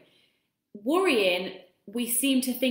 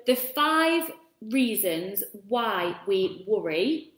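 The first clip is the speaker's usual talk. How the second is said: slow, spaced-out words with a lead-up; slow, careful; much slower than usual. Real, not acted. Host announcing the five reasons why we worry, slow and careful. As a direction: take each word of the tongue-twister slowly. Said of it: "that's actually really hard to say".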